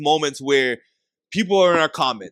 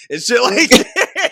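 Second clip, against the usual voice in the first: laughing through the words; 5 semitones higher, 4 dB louder, much slower than usual. Real, not acted. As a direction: chuckle as you finish the line.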